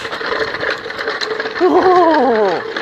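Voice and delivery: a bad, laughing whinny like Mister Ed; whinny like a talking horse, badly; horse whinny impression. Streamer mimics Mister Ed the talking horse with a whinny. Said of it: "That's a shitty horse impression".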